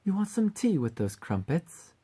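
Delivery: high-pitched British accent. Shown as a demonstration. Warming up for the succubus mascot role, high British accent. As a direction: put on a British lilt. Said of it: "I think I'm in the British accent now".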